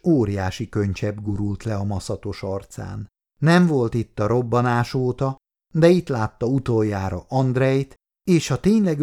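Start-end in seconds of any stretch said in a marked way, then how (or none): none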